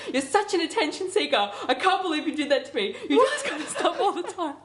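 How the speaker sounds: high-pitched mock scolding, laughter creeping in; retold angry yelling. Dreamer imitating friend accusing her in the dream, mock angry yelling. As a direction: hurl accusations in playful imitation. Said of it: "then you started yelling at me".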